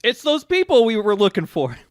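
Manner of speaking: mock sudden realization; prison guards. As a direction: call it out like a cartoon guard. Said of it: "the prison guards go".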